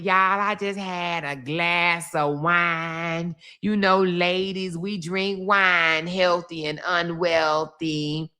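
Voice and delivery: slow, drowsy tipsy imitation of her; slow drowsy mock. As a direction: drag it out like she's nodding off. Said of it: "talking about".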